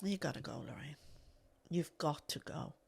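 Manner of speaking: quiet self-urging. Retold or imitated. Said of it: "and I was like".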